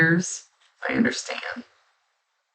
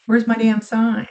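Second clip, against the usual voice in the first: exasperated griping; 7 semitones higher than usual. Retold or imitated. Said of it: "I go".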